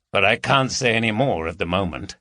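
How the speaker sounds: ordinary delivery, nothing marked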